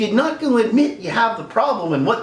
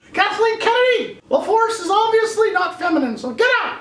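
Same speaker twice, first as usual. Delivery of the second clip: much higher cartoon Mickey voice with cackles; mock apology speech; 14 semitones higher than usual. Mickey at a podium apologizing to fans, high and giddy. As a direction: deliver a giddy cartoon press statement.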